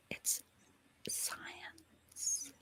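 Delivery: slow whisper; hushed reveal. Real, not acted. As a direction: whisper it like a secret. Hushed punchline to her explanation.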